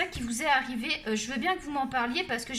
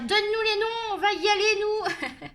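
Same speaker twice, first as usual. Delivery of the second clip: mock-rallying cry, high and loud; imitating indignant followers; 7 semitones higher, 5 dB louder than usual. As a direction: play fired-up fans rushing to defend her. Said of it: "Je suis sûre que vous allez dire". (imiter des abonnés remontés, fort et aigu)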